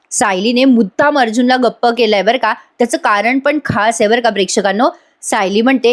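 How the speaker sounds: ordinary talk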